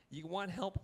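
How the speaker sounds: usual speaking voice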